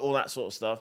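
normal talking voice

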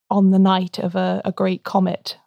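normal talking voice

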